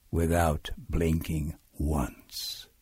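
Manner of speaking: low, slow, soft narration; hushed suspense. A narrator's reading. Max stares down the monsters, low slow hush. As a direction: drop low and space the words out. Magic staring trick tames monsters.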